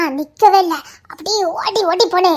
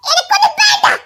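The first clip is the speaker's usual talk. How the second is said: sulky refusal; 12 semitones higher than usual. Acted. Daughter refuses angry father.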